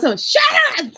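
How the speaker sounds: high-pitched shout at the honkers; mock yell. Driver yelling at cars blowing the horn, mock shout. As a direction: imitate an exasperated driver yelling. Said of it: "he turns around and yells".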